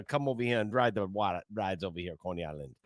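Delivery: put-on Jersey accent, tailing off; mock New Jersey accent. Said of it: "It's supposed to be New Jersey".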